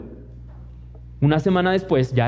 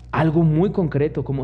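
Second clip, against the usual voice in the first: ordinary delivery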